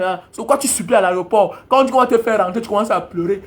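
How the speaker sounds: ordinary delivery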